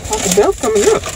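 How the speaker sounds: talking while chewing food. Real, not acted. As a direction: mouth partly full of food. Seen 0: Handles food wrapper, then lips pushed out as if chewing.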